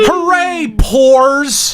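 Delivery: high, mock-jubilant hooray; sarcastic cheer. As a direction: cheer for them with exaggerated, insincere glee. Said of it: "is that sarcasm?".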